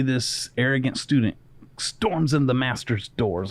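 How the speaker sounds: normal talking voice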